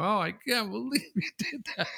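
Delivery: a mopey neighbor walking away; sulking senior. Retold or imitated. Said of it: "walk away sulking with their head down".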